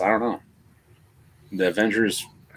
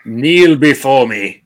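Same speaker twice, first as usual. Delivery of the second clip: slow, louder commanding villain voice; booming arrogant alien overlord; 8 dB louder, slower than usual. Retold or imitated. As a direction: demand they kneel, booming and slow. Quoting Zod in Superman debate.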